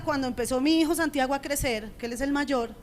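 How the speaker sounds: normal talking voice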